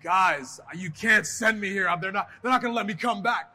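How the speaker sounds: loud protest. Retold or imitated. Wrestler pleading with bosses not to send him to Jamaica, loud. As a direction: insist loudly, half panicked. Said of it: "And I'm like".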